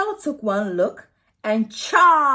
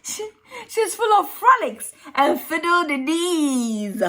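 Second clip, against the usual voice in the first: joyful frolicking; 8 semitones higher, 4 dB louder than usual. A narrator's reading.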